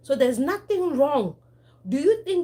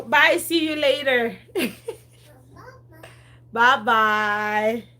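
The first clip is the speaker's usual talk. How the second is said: laughing goodbye; 3 semitones higher, 8 dB louder, much slower than usual. Real, not acted. Waving off the baby, laughing.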